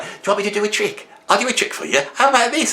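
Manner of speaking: high, fast, keen dog; eager dog voice. Retold or imitated. Eager dog offering tricks to its owner, high and fast. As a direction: overeager to please. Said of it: "they're like".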